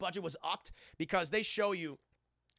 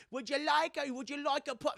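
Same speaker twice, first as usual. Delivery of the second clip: high, lightly put-on British schoolgirl; mock British girl; 8 semitones higher than usual. Schoolgirl offering her friend a cigarette, mock British lilt. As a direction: offer the puff in a cheeky posh lilt.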